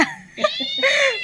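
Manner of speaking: high squealing laugh; a high, delighted shriek at the cat. Real, not acted. Cat reacts to ringtone.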